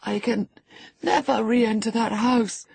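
high, gasping, fearful voice; gasp, then refuse in panic; frightened refusal. A narrator's reading. Terrified boy refuses his father's house.

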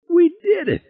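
very high triumphant cry; elated. A narrator's reading. Passenger celebrates as the sub keeps rising, high elated cry. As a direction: crow in a very high voice. Harry celebrates surviving the blast.

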